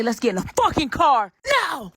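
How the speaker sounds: acted urgent order. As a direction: order it urgently, stretching the words.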